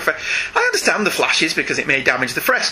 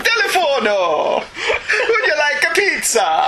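mock Italian accent; 8 semitones higher than usual. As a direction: ham up a Mario-style Italian cop. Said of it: "There's another accent to my repertoire. Italian".

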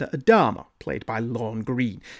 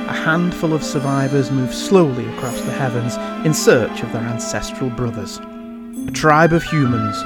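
dramatic sci-fi narrator; 3 dB louder than usual. Acted. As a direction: announce it like an epic space saga opening. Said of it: "where I did my best not to impersonate Patrick Macnee".

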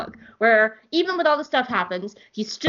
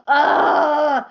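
disgusted groan; 3 semitones higher, 6 dB louder than usual. Real, not acted. Fan fed up with the comic, loud disgusted groan.

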